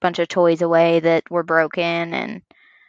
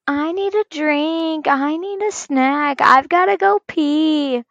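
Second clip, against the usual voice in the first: put-on slow, higher-pitched, drawn-out child's whine; whiny kid imitation; 7 semitones higher, slower than usual. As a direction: mimic kids stalling at bedtime, dragging each word. Mimics kids stalling at bedtime.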